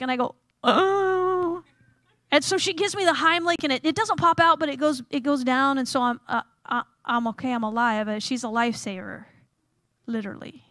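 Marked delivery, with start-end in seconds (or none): 0.6-1.6 choked groan. Retold or imitated. Answering while choking. 10.1-10.6 whispered aside. Real, not acted. Aside after lifesaver pun.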